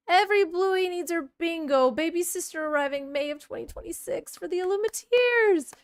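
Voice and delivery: high, bright announcement reading; excited read-aloud. Real, not acted. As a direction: read the news out, thrilled.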